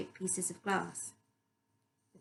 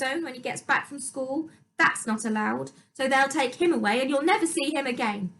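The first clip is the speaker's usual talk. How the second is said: vicious stepmother; 6 semitones higher, 8 dB louder than usual. A narrator's reading. Louise's threats to dad.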